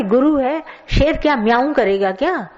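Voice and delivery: usual speaking voice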